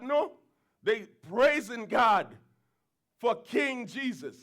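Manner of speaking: ordinary delivery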